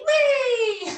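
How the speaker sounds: high, loud "whee" squeal; playful whee. Pretend. Teacher playing dog with children, high gleeful squeal. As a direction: let out a gleeful squeal, sliding down.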